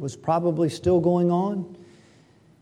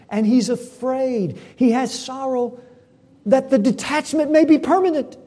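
higher and louder, driving each phrase home; full-force preaching; 5 semitones higher, 8 dB louder than usual. Acted. Pastor pleading with his congregation about sexual immorality, full-force preaching. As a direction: preach it hard to the whole room.

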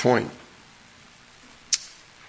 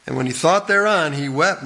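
raised, measured Bible reading; solemn King James reading, higher; scripture reading; 7 semitones higher than usual. Acted. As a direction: read the verse out to the congregation, slowing on the quote. Preacher reads the scripture aloud.

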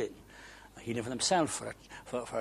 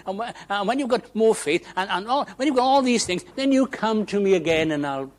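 high, lecturing list that builds louder; demanding rebuff; 8 semitones higher, 8 dB louder than usual. Acted. Preacher voicing a false God refusing a seeker, insistent rebuff. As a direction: pile up the conditions insistently.

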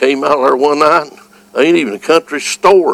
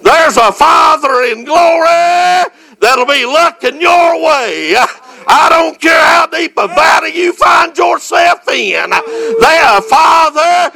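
preaching. Acted. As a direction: preach it out with full fire. Sermon climax, shouted praise.

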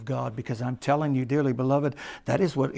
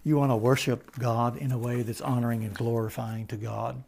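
ordinary delivery

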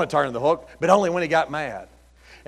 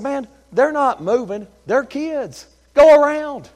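high-pitched exasperated telling-off; retold exasperation, rising in force; exasperated retort; 9 semitones higher, 5 dB louder, slower than usual. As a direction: plead the obvious, pitched up high. Acting out his exasperated retort.

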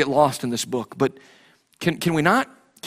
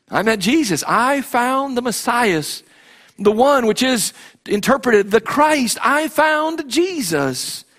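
high, slow and drawn out, ringing out the words; preaching at full force; 8 semitones higher, much slower than usual. Acted. Believer telling old friends he found Jesus, preached high and slow. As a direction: declare it like a triumphant testimony.

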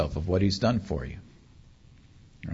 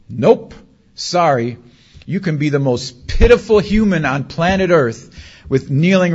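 loud, high, emphatic preacher delivery; impassioned preaching; 6 semitones higher, 6 dB louder than usual. Real, not acted. Preacher assuring the congregation they are instantly accepted, impassioned. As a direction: drive each phrase home with raised voice.

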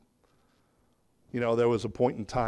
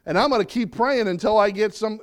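higher, louder, insistent complaint; complaining Habakkuk; 8 semitones higher, 8 dB louder than usual. Acted. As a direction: demand an answer from God. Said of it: "He started out going".

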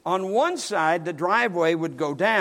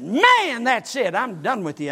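sharp, loud and much higher at the start; angry outburst; 5 semitones higher, 5 dB louder than usual. Retold or imitated. Pastor fed up with the teens, furious loud outburst. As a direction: blow up and storm off. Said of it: "And I said".

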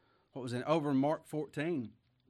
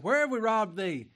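indignant question; 7 semitones higher, 10 dB louder than usual. Acted. Voicing the people's retort.

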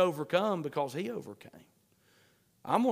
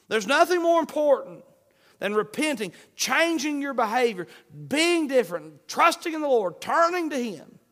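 loud, high, driving sermon voice; fiery preaching; 7 semitones higher, 9 dB louder than usual. Acted. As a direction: preach it hard, urging the crowd. Preaching at full force.

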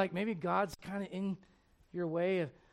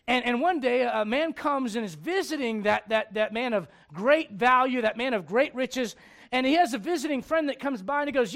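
loud, high fervent preaching; preaching at full force; 6 semitones higher, 13 dB louder than usual. Acted. Preacher telling Nathan's parable, full-force preaching. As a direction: preach it out to the crowd.

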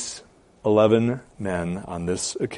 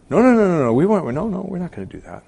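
higher, trailing-off string of denials; high-pitched imitated refusal; mock protest; 8 semitones higher, faster than usual. Voicing disciples' protesting denial.

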